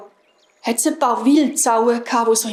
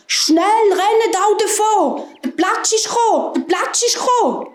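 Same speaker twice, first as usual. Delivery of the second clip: panicked hares; 9 semitones higher than usual. A narrator's reading. Fleeing hares warn the hedgehogs, high panicked calling. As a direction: sound the alarm in a high voice.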